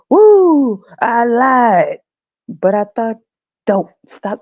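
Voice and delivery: loud, high gleeful cheer; high cackling glee from the punchline; gleeful whoop. Acted. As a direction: whoop it up like you got away with it. God gloating, shouting woo.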